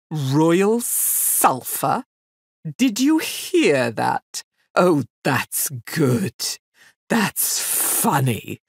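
slow, amused scorn; mocking fiend. Fiend mocking the girl's request, slow and amused. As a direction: savour the joke at the girl's expense. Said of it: "he slapped his sides and laughed even harder".

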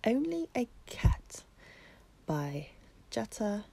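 usual speaking voice

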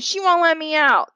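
put-on high, complaining child's cry; tattling shout. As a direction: pitch it up and complain loudly. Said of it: "shouted Francis".

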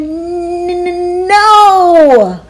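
high, sung-out then loud no; drawn-out defiant no. Acted. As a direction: stretch it and then shout it. Thomas refuses teacher.

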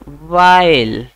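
वाइल stretched long and loud as VYLE; drawn-out model word. Shown as a demonstration. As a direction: hold वाइल out slowly for the class. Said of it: "पित्त को क्या बोलते हैं हम लोग?". (वाइल को धीरे और ज़ोर से खींचकर बोलना)

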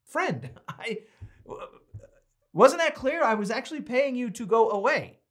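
incredulous, rising to a loud wasn't that clear; hammed-up disbelief at a pushy seller; exasperated quote. Speaker to the pushy seller, exasperated disbelief. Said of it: "I'm like".

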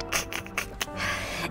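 hushed, breathy laugh; real giggle. Real, not acted. Streamer amused by a chat post, hushed laugh. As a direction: chuckle softly to yourself.

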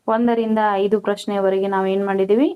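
ordinary delivery

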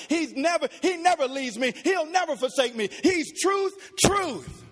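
impassioned, pitch climbing to the end; fervent preaching climax. Real, not acted. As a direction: preach it with rising fervor. Sermon builds to climax on truth.

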